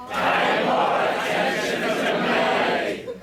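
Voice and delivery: slow, loud mock-Cockney chant; whingeing group chant, Cockney style; bad Cockney accent. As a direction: chant it in a badly faked Cockney. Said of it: "Bad Cockney style".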